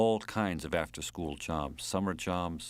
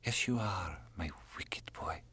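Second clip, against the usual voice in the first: whisper; 8 dB quieter than usual. Acted. Lover whispering in bed.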